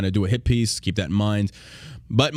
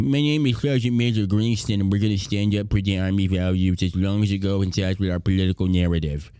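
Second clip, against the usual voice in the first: Officer Droopy; 4 semitones lower than usual. Retold or imitated. Host imitating the sergeant major as a droopy cartoon cop. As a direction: drag it out, dropping ever lower. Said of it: "sounding like Officer Droopy".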